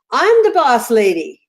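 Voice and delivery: emphatic mock-bossy proclamation, pitch swooping down; a little girl laying down the law, retold; bossy granddaughter. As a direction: imitate a small child declaring herself in charge. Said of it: "And she goes".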